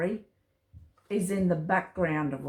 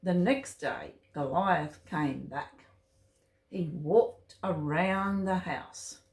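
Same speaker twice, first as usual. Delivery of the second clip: ordinary delivery, nothing marked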